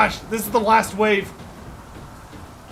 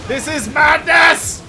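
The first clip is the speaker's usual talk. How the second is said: slow loud shout; dramatic shout; 5 semitones higher, 5 dB louder, slower than usual. Real, not acted. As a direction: bellow it out slowly.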